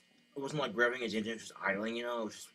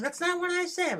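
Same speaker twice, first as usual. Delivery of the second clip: much higher, louder denial; defensive mom; 8 dB louder than usual. Retold or imitated. Mom denying her remark to dad, high defensive. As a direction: deny it squeakily. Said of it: "my mom's like".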